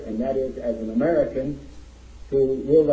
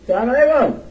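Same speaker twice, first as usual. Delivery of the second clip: loud, high, projected call to the crowd; rousing greeting; 13 semitones higher, 9 dB louder, much faster than usual. Real, not acted. Speaker trying the greeting again with the audience, rousing shout. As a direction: belt out the greeting to demand a bigger response. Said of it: "Let's try that again".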